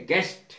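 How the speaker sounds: गेस्ट with G straight into E; silent U. Shown as a demonstration. Teacher modelling guest for students, गेस्ट with silent U. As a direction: say गेस्ट with no U or W glide. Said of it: "यू silent है".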